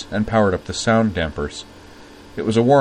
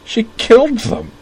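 "She killed them!" cried out far higher and louder, slow, killed wailed; breaking down; 8 dB louder than usual. A narrator's reading. Corwin breaking down in front of Utena, broken cry. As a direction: voice cracking as the tears come. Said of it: "as he repeated brokenly".

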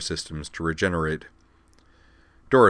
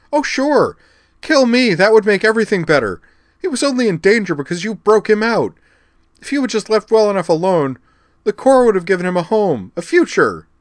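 much higher, louder, frantic cry; strident and desperate; 10 dB louder than usual. Acted. Liza cornered by a drawn blade, cries out in terror. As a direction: shriek it in desperate fear. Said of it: "strident with fear and desperation".

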